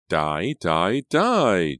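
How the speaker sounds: murderous shriek. A narrator's reading. Julia strangles Hannah on the coffin, shrieking. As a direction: scream it while strangling her. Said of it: "Julia shrieked at the top of her lungs".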